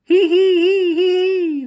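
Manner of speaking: very high, loud shrieking hee hee; strange hyena laughter. Acted. Hyenas hidden in the skull, shrieking high laughter. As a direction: cackle like a wild hyena. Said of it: "The strange laughter".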